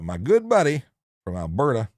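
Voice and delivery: hearty mock-Alberta accent. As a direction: put on a playful Alberta accent. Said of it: "putting on that accent".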